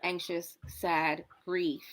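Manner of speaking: ordinary talk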